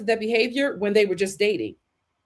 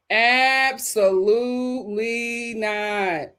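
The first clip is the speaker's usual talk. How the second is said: slow firm refusal; much slower than usual. Real, not acted. Drawn-out refusal.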